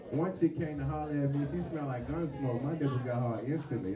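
ordinary delivery

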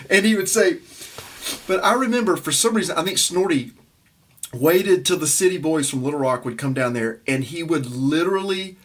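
ordinary talk, nothing marked

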